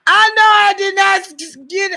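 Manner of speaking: can't get the word out for laughing. Real, not acted. Wife reading her own old card to viewers, laughing high and loud.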